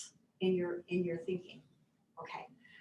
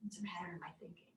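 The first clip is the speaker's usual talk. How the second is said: soft self-talk; 8 dB quieter, faster than usual. Retold or imitated. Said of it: "it helped me just to say".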